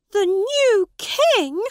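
high, loud and slow disbelief; astonished question. A narrator's reading.

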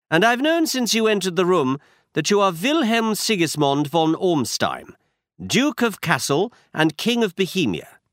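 brisk, sure Holmes explaining a clue; Holmes, lightly voiced.

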